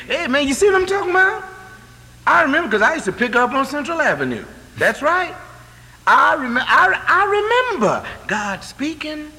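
high, loud, fast fervent preaching; preaching fervour. Real, not acted. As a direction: lift the congregation with a high, loud burst. Preacher builds to excited high pitch.

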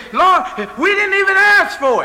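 very high, loud amazed exclaim; amazed cry. Retold or imitated. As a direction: cry out to the Lord in astonishment.

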